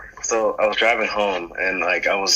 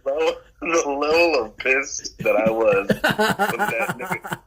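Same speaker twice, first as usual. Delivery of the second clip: laughing; 7 semitones higher, slower than usual. Real, not acted. Laughing recollection of anger.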